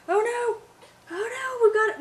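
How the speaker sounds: high-pitched "oh no" alarm; dismayed outcry. Real, not acted. Mom reacting as they start losing one, dismayed. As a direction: cry out high in sudden dismay. Something goes wrong with baby.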